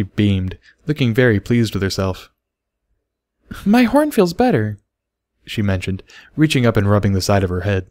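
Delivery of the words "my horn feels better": put-on very high little girl voice; high childlike relief; relieved filly. A narrator's reading. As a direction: say it like a soothed child.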